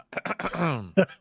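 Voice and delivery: zombie-like goblin noise; goblin sound. Pretend. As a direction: make a goblin noise on request. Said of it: "Can you do a goblin sound".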